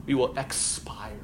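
breathy whisper, slow. Shown as a demonstration. Teacher whispering to the class about dying. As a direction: let the words fade out on the last breath.

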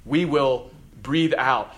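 ordinary delivery

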